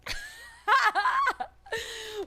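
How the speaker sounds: high, loud nervous laughter. Real, not acted. Scared of the crowd, bursting into nervous laughter.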